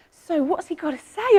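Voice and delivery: usual speaking voice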